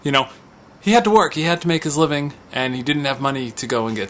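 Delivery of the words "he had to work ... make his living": ordinary talk, nothing marked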